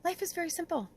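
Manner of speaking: high, loving lilt. Acted. Spirit speaking to you from a loving energy, lovingly. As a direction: say it lovingly, pitched well up. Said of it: "They're saying lovingly".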